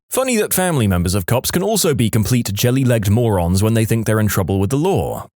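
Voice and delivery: ordinary talk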